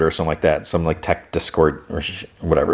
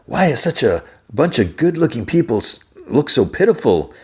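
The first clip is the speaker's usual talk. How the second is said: lightly voiced crew boss, pitched higher; 7 semitones higher than usual.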